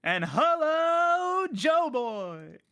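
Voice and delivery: huge drawn-out high cartoon hello; loud, stretched grand finale; SpongeBob. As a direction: belt out a long, high-pitched greeting. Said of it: "I'm gonna pull a SpongeBob".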